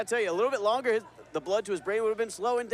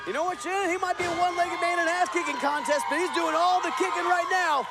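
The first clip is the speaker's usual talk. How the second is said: excited play-by-play; 6 semitones higher than usual. Real, not acted. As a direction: call the comeback at full pitch. Comeback move called live.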